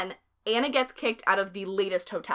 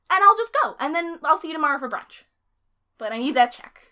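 high, louder pushy insistence; insistent demand; 7 semitones higher, 7 dB louder than usual. Retold or imitated. Friend voicing Rachel demanding the check. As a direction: push hard, won't budge.

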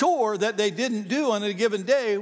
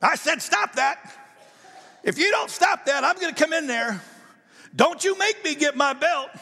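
raised, higher mock shout at the kids; retold angry yell; 8 semitones higher than usual. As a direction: bellow it from the dinner table like a frustrated dad. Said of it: "he would yell from the table".

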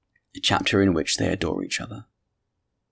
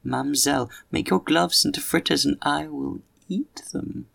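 a hint of a higher, playful suitor; lightly voiced gallant actor; 7 semitones higher than usual. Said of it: "he said to me".

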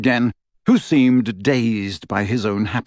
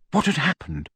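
ordinary delivery

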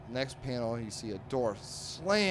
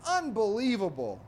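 high, louder mock exasperation; storming-off outburst; 11 semitones higher, 5 dB louder than usual. Dagwood storming into his house, mock-indignant exclamation. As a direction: exclaim it like a fed-up cartoon husband. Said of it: "Dagwood's storming into his house".

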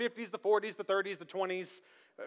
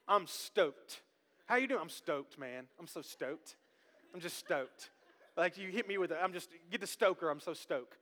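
a hint of a laid-back surfer, lower and much softer; eighties slang; 6 semitones lower, 9 dB quieter than usual. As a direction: mumble it like a chilled-out dude. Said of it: "words like stoked".

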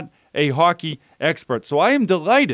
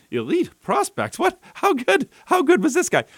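retold surprise; 9 semitones higher than usual. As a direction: pitch jumps much higher on how good, amazed disbelief. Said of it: "And when I saw that, I went".

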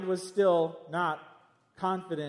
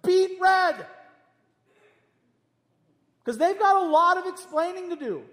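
exclaimed; 13 semitones higher, 7 dB louder than usual. Real, not acted. Picturing shamed people before God.

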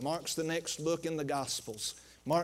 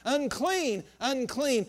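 mild mock cry; 7 semitones higher, slower than usual. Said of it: "you would have to cry out".

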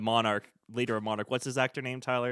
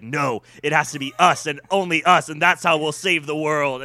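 loud, higher-pitched defiant protest; Bryan Cranston; 4 semitones higher, 9 dB louder than usual. Retold or imitated. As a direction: act out an impassioned, insistent objection. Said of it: "Bryan Cranston be like".

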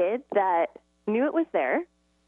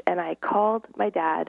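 usual speaking voice

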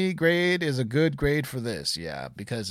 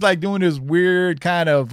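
normal talking voice

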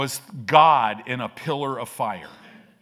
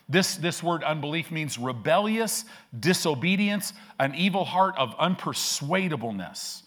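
ordinary delivery

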